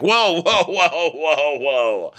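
high, loud startled whoas that settle down; mock alarm. Mocking the guy stopping her from the ring. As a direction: rein her in with escalating panic. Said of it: "He's like".

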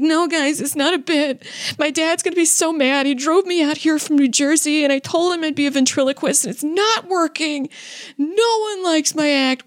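high-pitched imitation of a tearful plea; mock tearful protest. Failed ventriloquist pleading with a laughing crowd, mock tearful. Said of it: "he just broke into tears".

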